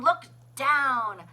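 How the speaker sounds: usual speaking voice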